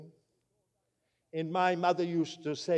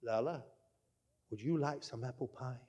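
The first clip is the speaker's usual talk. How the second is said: soft whisper, pitched lower; 6 semitones lower, 11 dB quieter than usual. Retold or imitated. Grandmother tempting the boy at the doorway, hushed whisper. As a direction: coax the boy softly with a whispered treat. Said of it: "she said in a whisper".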